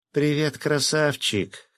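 high, slow female greeting; flirty woman act. A narrator's reading. Fisherman waving to a policeman as Sveta, flirty. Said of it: "отыгрывая роль".

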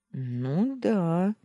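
a careful, hesitant agreement; cautious. A narrator's reading. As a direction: agree warily, testing the ground. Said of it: "осторожно подтвердил он". (осторожно, неуверенно)

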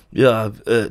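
usual speaking voice

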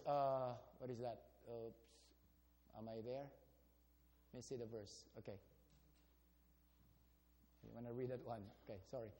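very low, soft searching mumble; muttering to self. Real, not acted. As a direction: think aloud under your breath. Hunting for the verse aloud.

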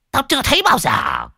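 high-pitched shouted exclamation; exclaiming. Acted. Gang member amazed by unknown trees, shouting high. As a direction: burst out loudly in disbelief.

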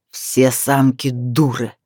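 low, slow growl of impotent fury; growling in helpless rage. Acted. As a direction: snarl it like a beaten villain. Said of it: "рыкнул в бессильной злобе Лайра".

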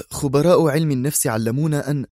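ordinary delivery, nothing marked

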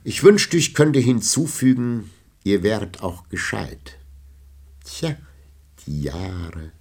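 lightly voiced sly poet's barb; a touch of sarcasm, trailing off; mocking jibe. A narrator's reading. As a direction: Villon needling the old knight on leaving. Villon's parting jibe.

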